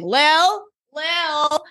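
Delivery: high, drawn-out mock call. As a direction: call her name as if into another room. Acted calling out for Lily.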